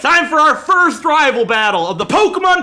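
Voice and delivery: dramatic challenge. Pretend. Player finally challenging his rival, hammed-up shout. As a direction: belt it like an anime hero. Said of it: "I've always wanted to say this".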